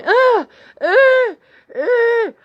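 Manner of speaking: high, loud mock moans; short high-pitched "ah" cries; imitated moans. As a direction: imitate loud noises coming through the wall. Imitating noises from other room.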